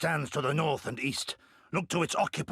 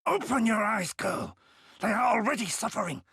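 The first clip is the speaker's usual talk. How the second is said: raised, forceful scolding; stern rebuke; 8 semitones higher than usual. Acted. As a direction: snap her out of her caution. Rebukes comrade over suffering villagers.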